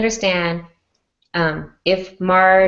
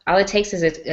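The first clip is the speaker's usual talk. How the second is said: ordinary talk, nothing marked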